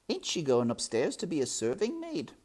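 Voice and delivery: put-on high, loud, hopeful servant girl; naive servant girl. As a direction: ask in a high, eager voice.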